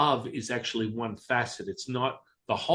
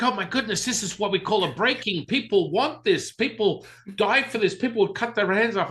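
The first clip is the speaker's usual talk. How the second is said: much higher, gushing with excitement; excited teacher; 9 semitones higher, 4 dB louder than usual. Retold or imitated. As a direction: imitate the teacher raving about it. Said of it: "my teacher was like".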